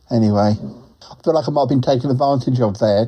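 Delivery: ordinary talk, nothing marked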